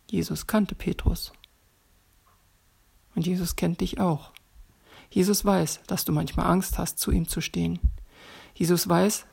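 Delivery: usual speaking voice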